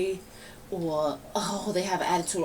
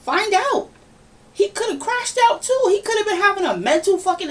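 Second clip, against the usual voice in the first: excited high pitch; 12 semitones higher, 6 dB louder than usual. Real, not acted. Heated defense of a friend.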